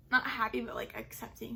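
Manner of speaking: ordinary talk, nothing marked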